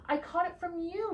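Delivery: mooed. Acted. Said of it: "mooed the pig".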